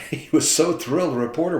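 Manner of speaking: laughing through the words. Real, not acted. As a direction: let the laugh carry the line. Laughs while retelling.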